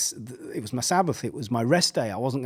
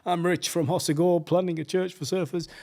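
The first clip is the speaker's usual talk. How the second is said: higher-pitched, put-on pushy introduction; mock self-promotion; 6 semitones higher than usual. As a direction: pitch yourself like a keen networker.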